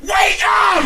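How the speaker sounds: high loud yell instead of a whisper; shouted wake-up. Retold or imitated. Husband waking his wife, ironic shouted whisper. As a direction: promise a whisper, then scream it. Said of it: "I just whisper in her ear".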